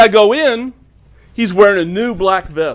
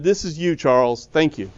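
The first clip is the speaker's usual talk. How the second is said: tender thanks; 4 semitones lower, 8 dB quieter than usual. Retold or imitated. Handing Charles the book.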